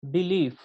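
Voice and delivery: Belief with the stress on the second syllable; Belief, leaning on its last syllable; iambic stress. Shown as a demonstration. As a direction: say Belief as weak "Be", strong "lief". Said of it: "तो ये iambic pattern है".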